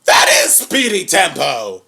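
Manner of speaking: loud, slow, delighted announcement; excited. Real, not acted. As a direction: point it out with glee. Excited recognition of voice actor.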